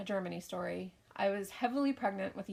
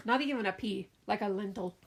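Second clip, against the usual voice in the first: ordinary talk, nothing marked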